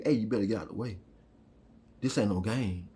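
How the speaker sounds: low, soft voice of the spirit; a quiet inner voice giving a stern warning; spirit's warning voice. Retold or imitated. The spirit warning you to get out of the way, low and soft. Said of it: "the spirit telling you".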